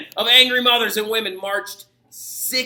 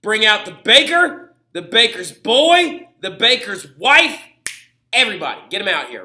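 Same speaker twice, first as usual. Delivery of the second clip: demanding crowd; 3 semitones higher, 6 dB louder than usual. Acted. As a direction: hammer on the gates and demand. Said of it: "and they say".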